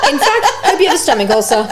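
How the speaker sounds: high-pitched, laughing gleeful jab. Real, not acted. Wishing illness on an ex, laughing hard. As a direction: say it through real laughter. Cracking up at her own curse.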